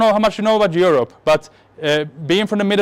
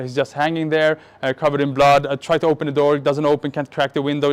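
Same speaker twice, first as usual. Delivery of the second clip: normal talking voice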